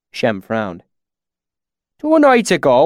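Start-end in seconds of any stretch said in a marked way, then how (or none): 2.0-2.8 high, loud country-dialect storyteller; rustic swineherd. A narrator's reading. Swineherd starting his account of strange lights, rustic and loud. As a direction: launch into a tale in broad bumpkin dialect.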